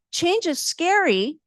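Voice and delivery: high, slow sing-song mockery of the city; mocking quote. Resident mocking the city's line at council, sneering sing-song. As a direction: mimic the official's patronizing line. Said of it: "For you to say".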